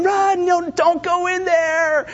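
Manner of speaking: high, stretched-out warning cry; hammed-up horror-movie yell; mock scream at the movie. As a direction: plead with the screen, half in fun. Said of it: "they're screaming at the movie".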